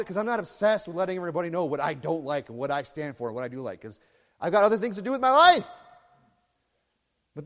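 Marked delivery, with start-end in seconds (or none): 4.4-5.7 raised, high-pitched exclamation; loud, exasperated preaching shout; exasperated outburst. Real, not acted. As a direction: burst out loud, fed up.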